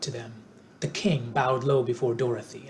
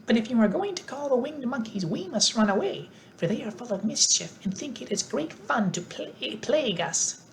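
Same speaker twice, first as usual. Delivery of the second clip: squeaky mouse queen; 9 semitones higher than usual. A narrator's reading. Queen of the Mice.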